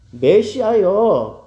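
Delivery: loud, high, drawn-out appeal; imitated calling out. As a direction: stretch the title into a slow loud call. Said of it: "그렇게 부른 거예요". (길게 늘여 크게 부르는 흉내)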